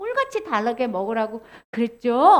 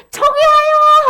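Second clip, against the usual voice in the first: high, loud warning cry; watchman's shout; 11 semitones higher, 10 dB louder, much slower than usual. Acted. Watchman warning that enemies are coming, loud high shout. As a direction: shout the alarm from afar. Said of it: "입을 크게 벌려서 외쳐야".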